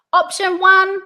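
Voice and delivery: loud, high "one" left open; pitch up. Shown as a demonstration. Coach models the first option, pitch up. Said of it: "Pitch goes up".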